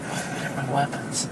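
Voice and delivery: hushed whisper under breath. Retold or imitated. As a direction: whisper it to yourself. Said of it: "they're thinking like".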